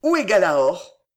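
loud, urgent and pressing; loud eager question from the old soldier rushing into the kitchen. Acted. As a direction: demand the horse at once, breathless with haste. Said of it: "d'une voix empressée".

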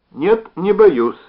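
slow, high, loud annoyed reply; irritated. Acted. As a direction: drag it out, clearly annoyed. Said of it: "раздражённо ответил он".

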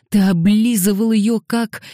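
high, forceful angry hiss; hissed accusation. A narrator's reading. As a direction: spit the accusation out, pitch and volume up. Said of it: "прошипел Дэниел". (злое шипение, громче и выше)

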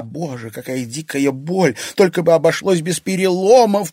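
ordinary delivery, nothing marked

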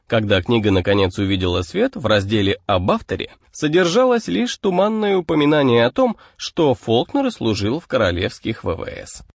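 usual speaking voice

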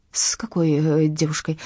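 ordinary delivery

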